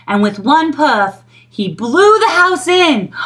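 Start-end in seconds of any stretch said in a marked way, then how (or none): 1.5-3.1 dramatic shout. A narrator's reading. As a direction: call out the crash. House blown down.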